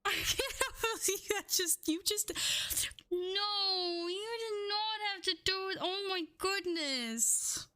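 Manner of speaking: high, emotional protest at a huge gift; astonished and moved, voice up high; overwhelmed disbelief. Real, not acted.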